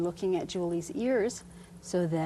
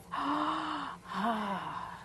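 deep breath in; 7 semitones higher, 5 dB quieter than usual. Real, not acted. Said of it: "Now I'd like you to take a deep breath".

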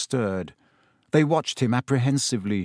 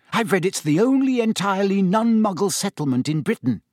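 eager and bright, voice lifted; keen bookish girl, eager to share; 7 semitones higher than usual. A narrator's reading. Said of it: "asked Hermione keenly".

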